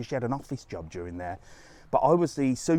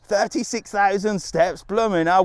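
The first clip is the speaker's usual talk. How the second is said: loud, raised exclamation; impressed amazement; 6 semitones higher, 5 dB louder than usual. Real, not acted. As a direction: repeat it in open astonishment. Repeats walker's step count, impressed.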